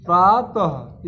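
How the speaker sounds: प्रातः said very slowly, with a breathy ह sound trailing after the vowel; drawn-out visarga demo. Shown as a demonstration. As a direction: stretch प्रातः and let the final breath of ः come out clearly at the end. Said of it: "इसको देखिए बाद में बोला जा रहा है". (प्रातः को बहुत धीरे बोलें, अंत में विसर्ग की ह जैसी हवा साफ़ सुनाई दे)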